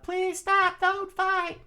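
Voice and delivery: very high, loud falsetto scream; pleading scream. Acted. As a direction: scream a plea over the battle. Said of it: "she screamed out loud".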